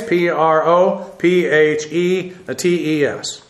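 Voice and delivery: P R O P H E T E S spelt slowly letter by letter; slow Greek spelling P R O P H E T E S. Shown as a demonstration.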